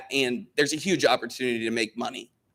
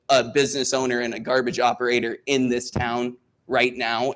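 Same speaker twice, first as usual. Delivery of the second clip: normal talking voice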